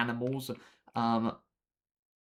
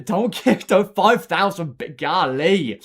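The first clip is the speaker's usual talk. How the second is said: excited protest; 6 semitones higher, 12 dB louder, slower than usual. Real, not acted. Viewer about to donate bits.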